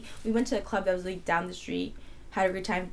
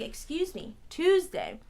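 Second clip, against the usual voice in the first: high-pitched, incredulous comeback, louder on Tuesday; indignant disbelief; 8 semitones higher, 4 dB louder, slower than usual. Retold or imitated. Traveler told to stay till Tuesday, indignant disbelief. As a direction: question it like you can't believe it. Appalled at news of long delay.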